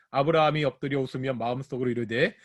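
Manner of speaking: quick, low-key scripture reading; brisk verse reading. Real, not acted. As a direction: read the verse straight through at a brisk pace. Reading the verse through aloud.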